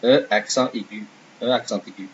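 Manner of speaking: "E accent aigu" in French, nasal "accent", ending "ay-gü"; name the accent in French, twice; "E accent aigu" as uh ak-SAHN tay-GÜ; French pronunciation. Shown as a demonstration. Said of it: "This is called".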